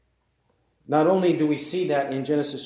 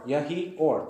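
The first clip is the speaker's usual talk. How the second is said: ordinary talk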